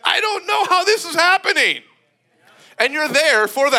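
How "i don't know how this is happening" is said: high, wondering voice of amazement; awestruck, can't explain it; amazed disbelief. Retold or imitated. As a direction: marvel that it's happening at all. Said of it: "you're like".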